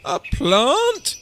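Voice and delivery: high, loud, rising disbelief; appalled mother's cry; horrified yell. A narrator's reading. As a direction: shriek it in outrage. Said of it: "yelled Mum in horror".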